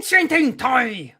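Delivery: mock dictator rant, high and loud; drawn-out, hammed-up German shouting; Hitler. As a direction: bellow it like a parody demagogue. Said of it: "act like Hitler".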